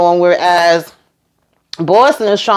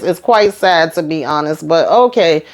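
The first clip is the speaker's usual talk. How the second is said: ordinary talk, nothing marked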